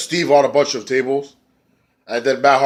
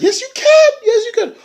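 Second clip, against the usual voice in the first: very high, louder, crying squeaky encouragement; tearful Allie; 5 dB louder than usual. Retold or imitated. Allie urging Maria to wrestle, very high tearful squeak. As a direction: plead through tears with a squeak. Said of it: "And Allie's like".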